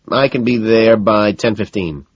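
slow, calculating delivery; slow counting. A narrator's reading. Van Patten works out his arrival time, slow counting. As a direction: work it out slowly as you speak. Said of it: "slowly counting the minutes".

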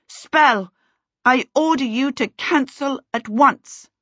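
high, forceful ordering; shouted command. A narrator's reading. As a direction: command it like an urgent spell. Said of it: "she shouted".

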